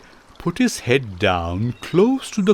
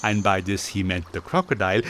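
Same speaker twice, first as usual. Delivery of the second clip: ordinary delivery, nothing marked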